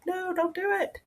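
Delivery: sudden high little voice pleading no; high, urgent inner-voice plea; inner nerves' protest. Retold or imitated. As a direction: squeak it out like your body's alarm. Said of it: "every single nerve in your body was saying".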